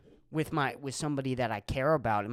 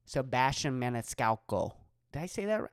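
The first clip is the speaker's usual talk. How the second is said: normal talking voice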